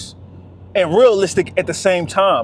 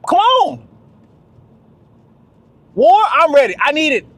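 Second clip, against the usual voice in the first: hyped battle cry; 6 semitones higher than usual. Real, not acted. Daring the world to bring it, in a fired-up shout. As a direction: call out the fight like a war cry.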